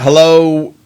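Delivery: ordinary delivery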